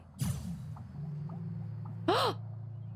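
high, unvoiced gasp of surprise; a startled intake of breath. Real, not acted.

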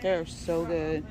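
ordinary delivery

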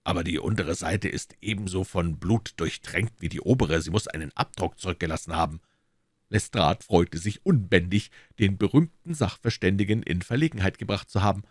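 ordinary delivery, nothing marked

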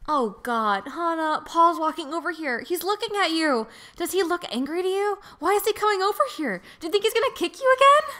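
rising panic. Acted. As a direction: spiral into frantic worry. Voices Mai panicking.

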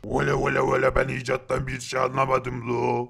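dim giant Tepegöz. Acted. Tepegöz tells Nop he did not get the invention, in a low dull voice.

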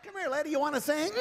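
normal talking voice

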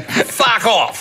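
high-pitched mock yell of dismissal; imitating a boozy, irate actor; drunken bellow. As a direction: yell it like a drunk through an intercom. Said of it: "he used to drink a fair bit".

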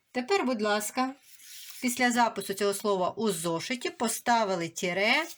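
ordinary delivery

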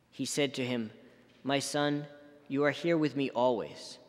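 usual speaking voice

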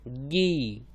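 गी as hard g plus long ee; blended syllable demo. Shown as a demonstration. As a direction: hold the vowel in गी. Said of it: "तो आई के साथ मिलके".

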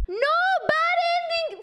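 long, high, drawn-out cry of "Bad ending"; anguished wail. Real, not acted. Streamer to chat on getting the bad ending again, a loud, high, slow wail.

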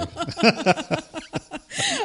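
real high-pitched burst of laughter. Real, not acted. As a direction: let the laugh break out high. Loud laugh, no words.

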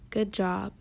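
ordinary delivery

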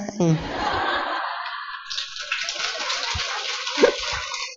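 airy wheezing high laugh; high breathless laugh. Real, not acted. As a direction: laugh silently at your own joke. Speaker laughs at own punchline.

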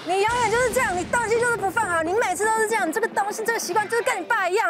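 retold furious scolding. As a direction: unload all your anger on the kid. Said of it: "我就把这疫情间的火全部发出来".

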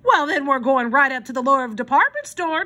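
lightly voiced bold declaration; declaring. A narrator's reading. Armand promising the children a visit, lightly voiced declaring. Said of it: "declared Armand".

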